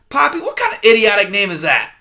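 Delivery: put-on loud, grumbling old porcupine; loud cranky old porcupine; grumpy old porcupine. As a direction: snap at the intruder like a cranky old coot. Erith voiced in story.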